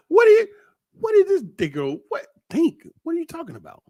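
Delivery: high, scoffing disbelief; a mock outraged squeak; incredulous. Real, not acted. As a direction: act stunned by a silly question. Mocking his own advice.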